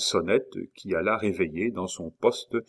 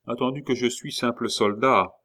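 plain reading voice

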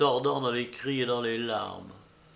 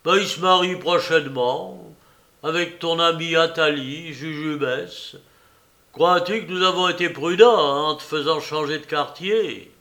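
gloating; 3 semitones higher, 6 dB louder than usual. Acted. Maroquin gloats at Georgette.

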